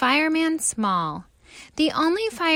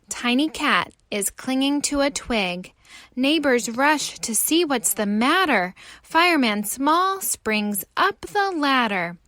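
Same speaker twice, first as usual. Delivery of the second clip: usual speaking voice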